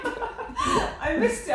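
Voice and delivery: high, drawn-out laughter into the words; real laughing. Real, not acted. Co-host caught not listening, laughing. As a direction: laugh helplessly as you admit it.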